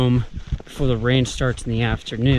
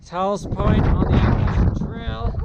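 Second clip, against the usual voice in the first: raised, higher voice against the wind; shouting over wind; 8 semitones higher than usual. Real, not acted. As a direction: call out over the gusts on a summit. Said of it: "if you can hear me through the wind".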